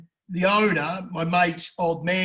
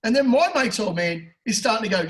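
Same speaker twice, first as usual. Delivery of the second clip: ordinary talk, nothing marked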